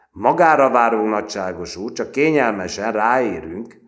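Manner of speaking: loud, high sarcastic politeness; mocking. A narrator's reading. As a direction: jeer at the slowcoach before the whole company. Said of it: "gúnyosan nézte a kapkodását".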